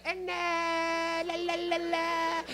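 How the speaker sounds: high, drawn-out droning syllables; mock a shop lady stalling on one held high note; high singsong drone; imitated store lady. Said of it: "she'll be like".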